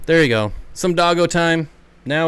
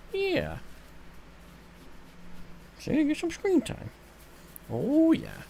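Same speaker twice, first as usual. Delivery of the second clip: high, soft you're so needy cooed at the dog; 13 semitones higher, 8 dB quieter, slower than usual. Real, not acted. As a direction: coo at the needy puppy. Baby talk to pet.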